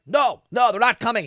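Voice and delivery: mock panic, pitched high; hammed-up freak-out. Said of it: "He, like, freaks out".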